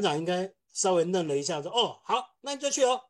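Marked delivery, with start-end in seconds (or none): 1.7-3.0 higher, taken-aback "oh, ok"; surprised boss. Retold or imitated. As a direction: sound a little stunned. Boss caught off guard.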